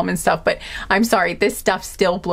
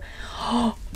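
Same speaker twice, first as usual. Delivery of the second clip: sharp gasp; 3 semitones higher, 9 dB quieter than usual. Real, not acted. Sudden realization mid channeling.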